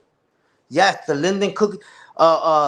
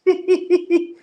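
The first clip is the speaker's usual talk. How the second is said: high laugh; 11 semitones higher than usual. Real, not acted. Laughs after impression.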